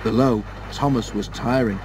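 plain reading voice

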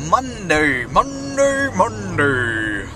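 monster truck rally voice. Pretend. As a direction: hype Monday like a monster truck ad. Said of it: "my monster truck, uh, rally voice".

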